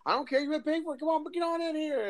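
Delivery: high, cheery, welcoming; high friendly voice. Retold or imitated. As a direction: insist warmly, pitched high. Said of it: "he's like".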